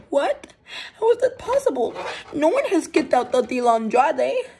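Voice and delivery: high, loud disbelief; shocked disbelief. Pretend. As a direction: react as if the pin should have won. Shocked commentator.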